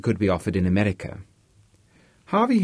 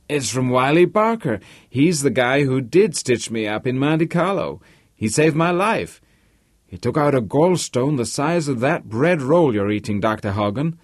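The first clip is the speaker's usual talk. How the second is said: higher-pitched, animated Polish-American tycoon; loquacious American host; 5 semitones higher, 3 dB louder than usual. Acted. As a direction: play the talkative host, ending in exasperation. Narrator voices Harvey telling his guests.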